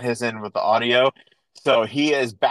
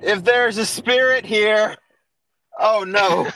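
pitched up, calling out to a spirit; mock séance call; 9 semitones higher than usual. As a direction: summon the spirit in a raised, drawn-out voice.

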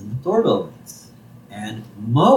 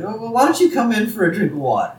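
much higher, kindly Marge voice; 10 semitones higher, faster than usual. Retold or imitated. Marge inviting sad Moe inside, high and kind. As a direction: coo the offer the way Marge would. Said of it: "And Marge's like".

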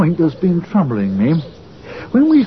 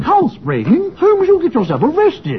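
alarmed outburst; 10 semitones higher than usual. Acted. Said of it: "continue shouting about it".

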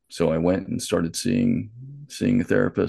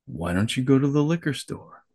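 tempting inner voice; 6 semitones higher than usual. Retold or imitated. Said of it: "a voice that was not my voice saying".

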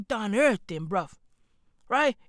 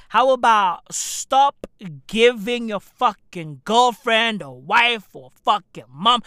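ordinary talk

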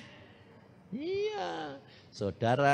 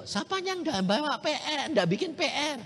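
higher-pitched teacher calling out the class; teacher's question; 14 semitones higher than usual. Retold or imitated. Teacher asking the class who skipped homework, higher pitched. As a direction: ask it like the teacher at roll call.

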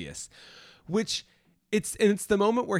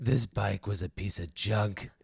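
low, put-on movie-star impression; Marlon Brando; 8 semitones lower, 4 dB quieter than usual. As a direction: drop the pitch well down into an impression. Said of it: "It's like Marlon Brando all of a sudden".

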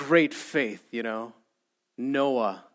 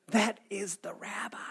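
higher, much softer stage whisper; scandalized whisper; 4 semitones higher, 8 dB quieter than usual. Acted. Crowd warning the blind man about the rabbi, whispering. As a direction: whisper it as a shocked reprimand.

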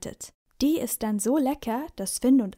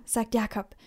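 ordinary delivery, nothing marked

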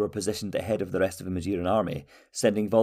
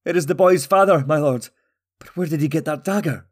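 higher, louder villager voice; agitated prisoner; 7 semitones higher, 7 dB louder than usual. A narrator's reading. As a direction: answer urgently, eyes wide. Prisoner answers the king.

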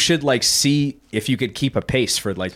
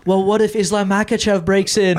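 scared daughter; 8 semitones higher, 4 dB louder than usual. Retold or imitated. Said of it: "And now she's like".

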